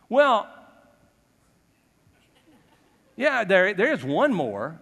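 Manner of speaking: a father who would rather not say it; hammed-up hesitant Jesse; reluctant father. Jesse reluctantly admits another son.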